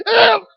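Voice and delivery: sudden high squeal of disgust; imitated squeal. The boy not picked, high squeal. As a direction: squeak it out like a let-down kid. He went.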